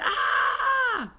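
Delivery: long, high, loud shriek; scariest scream. A narrator's reading. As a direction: shriek to make them jump. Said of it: "I did my scariest scream".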